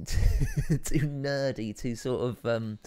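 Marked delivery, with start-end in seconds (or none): 0.0-1.8 laughing. Real, not acted. Laughing at the casting idea.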